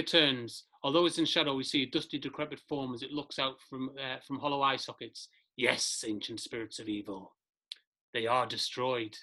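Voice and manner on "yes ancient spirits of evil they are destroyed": villain voice. Acted. Decrepit mummy villain answers spirits.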